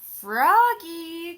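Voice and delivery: long, loud high call across the yard. Acted. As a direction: call him from far away. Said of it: "called his mother".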